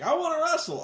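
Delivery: high, peeved mock whine; put-on petulant demand; Douglas Williams. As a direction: imitate a sulky wrestler demanding his turn. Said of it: "Williams has a real bug up his ass".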